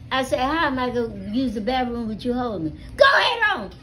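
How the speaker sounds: ordinary talk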